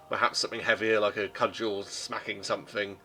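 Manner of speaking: usual speaking voice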